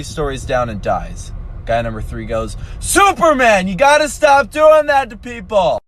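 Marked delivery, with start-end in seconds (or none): 2.8-5.8 exasperated shout. Acted. Third guy shouting at Superman after his friend falls, exasperated shout. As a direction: yell it out high and loud, fed up.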